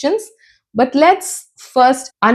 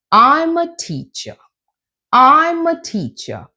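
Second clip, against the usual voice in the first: I'M starts high, TEACHER drops down; falling intonation; 5 semitones higher than usual. Shown as a demonstration. Teacher giving information about herself, falling intonation. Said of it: "at the end of the sentence you go down".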